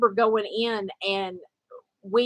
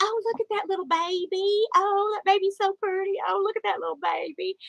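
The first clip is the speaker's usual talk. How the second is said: put-on high cooing voice of strangers admiring a baby; cooing passerby; 10 semitones higher than usual. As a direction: coo high like a stranger peeking into a stroller.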